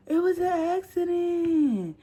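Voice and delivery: high slow whiny it was an accident; whiny plea. Retold or imitated. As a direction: plead innocence. Pleading innocence.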